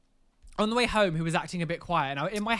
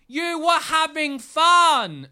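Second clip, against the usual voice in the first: higher, louder, slow indignant retort; indignant quote; 10 semitones higher, 7 dB louder, much slower than usual. Retold or imitated. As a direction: throw it back at him, incredulous. Retelling argument with boyfriend.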